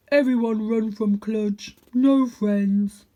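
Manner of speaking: like a sad giant stating facts; lonely big dog. A narrator's reading. Big dog telling the cat nobody stays, slow.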